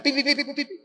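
high, quick mouth-made horn beeps; car horn beeps. Retold or imitated. The taxi driver honking at him on the street, high beeping horn sounds. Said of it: "Entonces el tipo pita".

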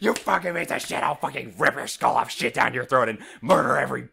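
mock furious threat. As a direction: rant it like an unhinged threat. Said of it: "you come back at me with".

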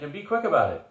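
impatient general voice; demanding. Acted. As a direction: snap it out at them. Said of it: "he demanded".

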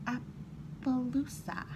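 ordinary delivery

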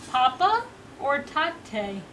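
drawn-out sing-song offer; slow, drawn out. Real, not acted. Slowly offering baby two words.

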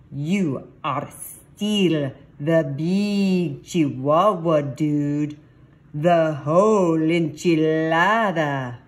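loud, slow, hammed-up Spanish accent. A narrator's reading. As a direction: lay on a big, drawn-out Spanish accent. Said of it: "using his very best Spanish accent".

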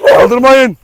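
loud, high sharp yell; shouted warning at fighting dogs; shouting at dogs. Real, not acted. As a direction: yell to stop the dogs lunging. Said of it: "Bağırmasam dalıyordu onlar".

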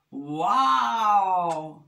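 loud, long-held wow; big drawn-out storybook shout; amazed shout. A narrator's reading. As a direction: shout it out, stretching the vowel. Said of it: "shouted Little Bear".

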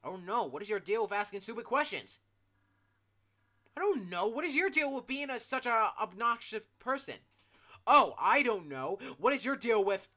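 loud rising mock quarrel; mock argument. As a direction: bicker loudly with the character. Acted quarrel escalating.

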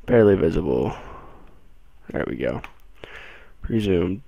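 ordinary delivery

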